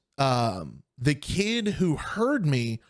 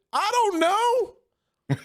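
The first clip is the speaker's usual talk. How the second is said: put-on much higher and very slow, drawn-out child voice; helpless little kid; much slower than usual. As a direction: stretch it out like a clueless seven-year-old. Kid answering his stepdad.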